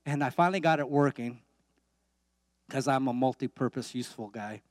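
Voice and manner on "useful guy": ordinary talk, nothing marked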